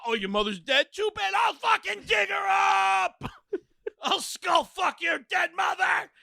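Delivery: high shouted comic threats; mock tirade. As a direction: bellow absurd threats. Mock rant.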